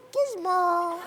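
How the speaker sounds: very high little creature voice; squeaky coo of a fuzzy creature; Gizmo. Shown as a demonstration. Voice actor running through his roles, high creature squeak. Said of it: "So this is".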